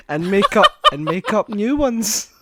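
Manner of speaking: laughing through the words. Real, not acted. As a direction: crack up mid-sentence. Breaking into laughter.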